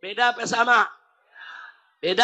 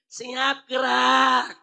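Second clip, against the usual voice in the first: limp command; much slower than usual. Shown as a demonstration. Weak general mumbling an order to troops, limp. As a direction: say it weakly, no force behind it. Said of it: "kalau jenderalnya nyuruhnya begini".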